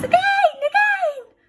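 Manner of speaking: put-on high, loud gleeful baby cry; squealing baby voice; baby's happy shout. A narrator's reading. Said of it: "the baby laughs and shouts".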